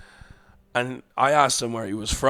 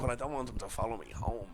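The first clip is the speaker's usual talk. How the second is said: softer, worried inner voice; quiet worried thought; 3 semitones lower, 10 dB quieter than usual. Retold or imitated. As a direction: think it to yourself, uneasy.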